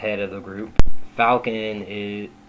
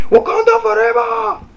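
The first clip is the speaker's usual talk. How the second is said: excited shout; 9 dB louder than usual. Pretend. Fans cheering after movie release.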